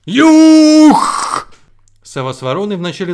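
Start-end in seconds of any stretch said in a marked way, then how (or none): 0.1-1.5 huge high shriek; sparrow's yell. A narrator's reading. Sparrow terrifies the owl and crow, screaming at full force. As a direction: scream it with all your might. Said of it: "как закричит".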